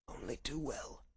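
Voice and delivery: very soft, weak reply; feeble invalid. Acted. Sick Holmes admits he knows symptoms, feeble voice. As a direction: answer faintly, nearly spent.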